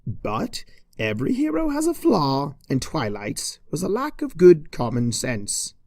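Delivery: ordinary delivery, nothing marked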